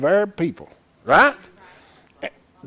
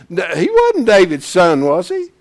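ordinary delivery

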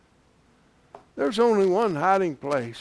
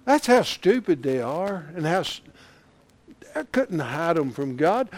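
ordinary delivery

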